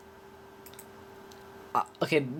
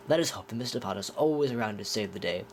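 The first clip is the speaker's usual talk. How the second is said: put-on lower, haughty British accent as Lucius Malfoy; 3 semitones lower than usual. Quoting Lucius Malfoy to Harry in a British accent. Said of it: "I'm speaking in a British accent".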